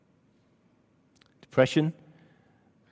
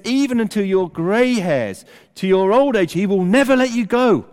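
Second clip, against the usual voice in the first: preaching that builds louder and higher; emphatic promise; 5 semitones higher, 6 dB louder than usual. Acted. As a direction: build to a ringing assurance. Preacher proclaims God's promise.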